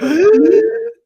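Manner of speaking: loud high gasp of shock; big mock gasp. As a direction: gasp like you just fell over laughing. Acting out laughing on floor.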